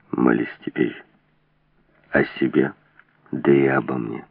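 very low, grim order; low menacing voice; grim command. A narrator's reading. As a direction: say it darkly, like a death sentence. Armed man orders her to pray.

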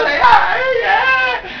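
high, wailing "ay, ay" of a grieving man; mock wailing. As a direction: cry out in hammed-up grief. Mimics Abraham wailing over Sara. (lamento fingido de Abraham)

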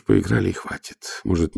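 ordinary delivery, nothing marked

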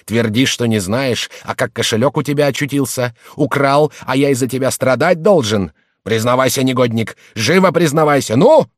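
angry interrogation building to a loud bark. A narrator's reading. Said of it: "разозлился Гэнске".

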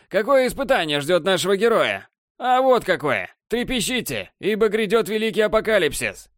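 высокое театральное вещание; вещай с надрывом, как пророк апокалипсиса; надрывный пафос. Acted. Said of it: "вещал Борланд с надрывным пафосом". (надрывный пафос)

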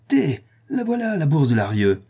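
sudden high excited cry; exclaiming. A narrator's reading. Anicet showing the purse, excited exclaim. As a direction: burst out with the find. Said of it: "s'écria Anicet".

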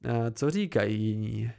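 usual speaking voice

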